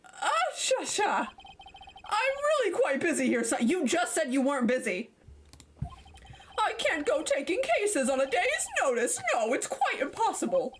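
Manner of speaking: a hint of a blustering elder lawyer greeting a visitor; pompous old lawyer Grossberg. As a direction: puff up like a self-important senior lawyer.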